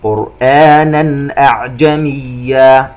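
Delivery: slow chant, clear n in "قرآنا" before "أعجميا"; throat izhar. Shown as a demonstration. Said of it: "إظهار حلقي". (ترتيل بإظهار تنوين "قرآنا")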